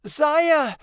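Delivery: very high, loud call; yelled. A narrator's reading. Midnight Star yells for Zaya in the forest. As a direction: shout a name into the trees. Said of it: "As she yelled".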